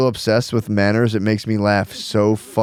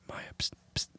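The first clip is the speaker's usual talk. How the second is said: conspiratorial whisper. Retold or imitated. Dad secretly egging on daughter on the plane, conspiratorial whisper. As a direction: whisper a naughty prompt to a child.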